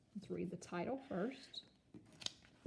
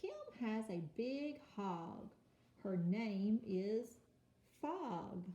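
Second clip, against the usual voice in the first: slow reading aloud; 2 semitones higher, much slower than usual. Shown as a demonstration. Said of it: "Let's read that again".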